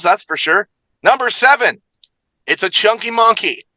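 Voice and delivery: game-show style host with high, punched countdown calls; big showy countdown host voice; hyped recap announcer. Acted. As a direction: belt out each number, then ease into the comments. Hosts recap as Ralph McGee.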